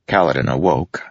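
usual speaking voice

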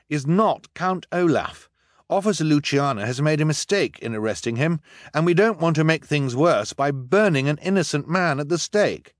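high, firm pleading; earnest boy. Acted. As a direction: defend the innocent man. Klaus defends Jacques.

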